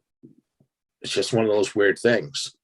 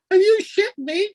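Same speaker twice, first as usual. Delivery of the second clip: disbelieving shout. Retold or imitated. Seeing a little guy at the window, yelping in disbelief. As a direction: yelp it like you can't believe your eyes. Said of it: "I go".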